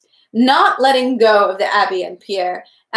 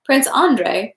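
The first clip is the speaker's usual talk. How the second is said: usual speaking voice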